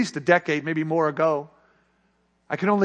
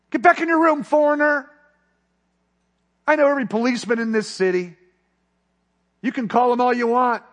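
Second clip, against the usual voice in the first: lightly voiced pimp, higher and louder, mocking; threatening pimp; 5 semitones higher, 4 dB louder than usual. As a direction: push the foreigner away, sneering.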